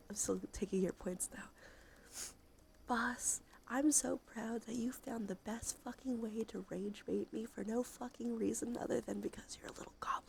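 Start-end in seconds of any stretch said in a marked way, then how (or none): none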